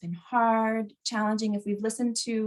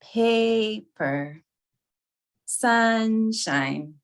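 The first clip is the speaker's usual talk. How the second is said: "Paper, sunshine" with PA and SUN held long, then dropping; strong first syllables; 3 dB louder, much slower than usual. Shown as a demonstration. Teacher leading class to stand on stresses, first syllables of "Paper, sunshine" held. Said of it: "stand with all the strong syllables".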